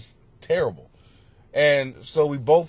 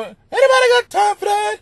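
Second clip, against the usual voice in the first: high, loud mocking imitation; viral lady impression; 7 dB louder, faster than usual. Comic riffing on the ringtone, high mocking voice.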